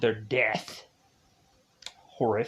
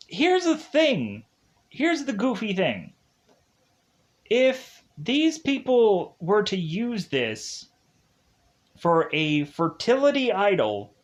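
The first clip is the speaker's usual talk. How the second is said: higher, slow setup for a joke; teasing setup; 6 semitones higher than usual. Real, not acted. Narrator setting up a joke about the idol, teasing and high. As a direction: tease the audience before the punchline. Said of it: "building up a joke".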